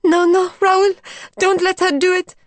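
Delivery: high, loud, pleading cry; high panicked plea; frightened cry. A narrator's reading. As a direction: beg him in panic to stop her. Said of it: "gave a cry".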